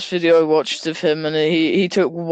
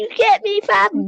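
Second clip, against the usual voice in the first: very high mock baby voice; squeaky roadman baby, hammed up; newborn roadman; 14 semitones higher than usual. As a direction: plead as a roadman fresh from the womb. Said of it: "comes out the womb talking".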